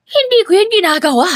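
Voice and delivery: fast, high, rattled denial; shaky voice. A narrator's reading. Stepmother denying abuse before the father, voice shaking. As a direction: deny it quickly, voice wobbling. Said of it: "nanginginig ang boses".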